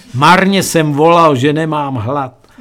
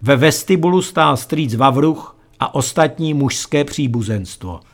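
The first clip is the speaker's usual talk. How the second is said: usual speaking voice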